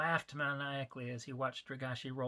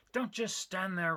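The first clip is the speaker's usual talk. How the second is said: yelled; 5 semitones higher, slower than usual. Acted. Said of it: "Rigashi yelled at his man".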